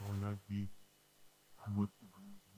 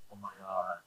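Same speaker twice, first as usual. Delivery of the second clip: much softer, lower, breathed out; stunned hush; 7 semitones lower, 19 dB quieter than usual. Retold or imitated. Seeing the man fall, soft stunned hush. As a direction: hush of shock. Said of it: "I'm like".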